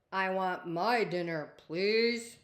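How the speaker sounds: loud creature plea; a beast trying manners; beastie voice. Acted. Beastie asking the princess for dinner, loud.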